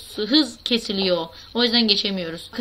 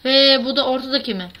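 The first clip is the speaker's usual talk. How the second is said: normal talking voice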